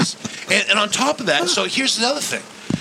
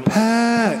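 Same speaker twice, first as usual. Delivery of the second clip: high, sustained echoing holler; drawn-out call; 8 semitones higher, 3 dB louder than usual. Pretend. Co-host calling from afar, high drawn-out call. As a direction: call the name down a tunnel. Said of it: "and you were like".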